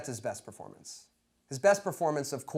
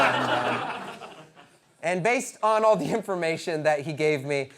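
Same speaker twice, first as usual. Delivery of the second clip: ordinary delivery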